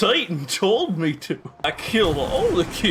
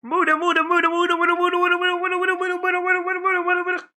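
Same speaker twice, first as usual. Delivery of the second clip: high, rapid chanting; anime battle cry; 10 semitones higher, much faster than usual. Pretend. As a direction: rattle it off like an anime barrage. JoJo stand barrage chant.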